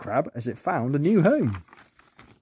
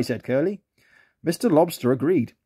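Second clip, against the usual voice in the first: ordinary delivery, nothing marked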